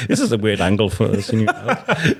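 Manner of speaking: laughing. Retold or imitated. Laughing about FaceTime angle.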